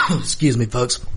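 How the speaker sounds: strained, fading voice after shouting; hoarse voice. Real, not acted. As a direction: talk through a voice that's giving out. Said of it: "I am losing my voice".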